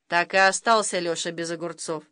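ordinary delivery, nothing marked

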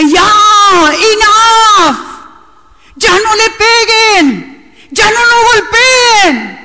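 grito agudo y lento entre sollozos, mucho más alto; grito entre llanto. Retold or imitated. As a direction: suplicar a gritos que paren, con la voz rota. Gritándole a la película llorando. (gritando y llorando ante la pantalla)

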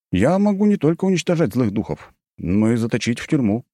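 cheerfully smug boast. Acted. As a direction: show off with a pleased grin. Hero's smug reveal.

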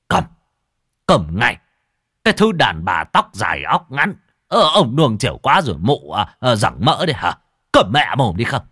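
loud, enraged shout to shut up; furious yelling. Acted. Husband yelling at wife to shut her mouth, loud. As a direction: bellow her into silence.

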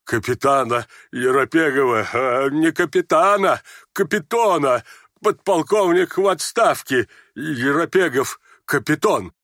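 put-on halting, muddled old man; confused babbling. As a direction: stumble slowly through the name, correcting yourself. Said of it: "Старик проболтал, совсем сбившись". (сбивчиво, путаясь в словах)

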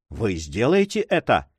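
ordinary delivery, nothing marked

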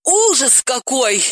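high, slow wail of horror; cry of horror. Acted. Dalila cries out in horror at Sobolev's news. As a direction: wail it out in dismay. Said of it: "вырвался вопль".

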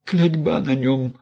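высоко, медленно тянет «матушка»; голоси, бухнувшись на колени; вопль. A narrator's reading. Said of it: "завопила Мелания". (высокий протяжный вопль)

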